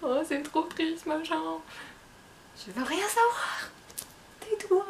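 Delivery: high, drawn-out mock lament; hammed-up high-pitched protest; best friend's dramatic message. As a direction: imitate a friend wailing over a sad book, high-pitched. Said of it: "elle m'avait envoyé un message en disant".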